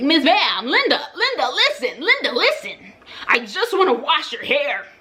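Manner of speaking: exasperated pleading with a client. Retold or imitated. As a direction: coax her sweetly, begging to just wash her hair. Pleading with client to let her wash hair.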